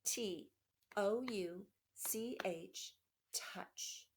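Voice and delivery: slow letter-by-letter spelling, each letter apart; tapping out a word. Shown as a demonstration. Teacher taps out touch for young students, slow and spaced. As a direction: spell it out slowly for kids tapping along. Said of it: "One more time".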